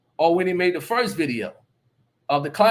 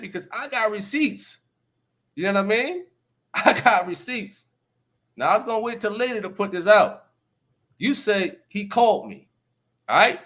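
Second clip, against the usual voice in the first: ordinary talk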